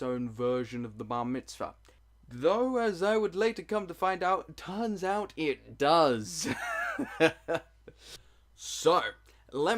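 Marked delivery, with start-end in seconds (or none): 2.3-6.5 dramatic reveal. Acted. Comic reveal. 6.5-7.6 laughing. Real, not acted. Laughing at own joke.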